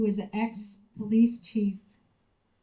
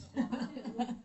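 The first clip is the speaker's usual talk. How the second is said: quiet airy giggle; hushed disbelieving laugh; soft breathy laugh; 7 dB quieter than usual. Real, not acted. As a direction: let a little laugh slip. Reacts to handshake story.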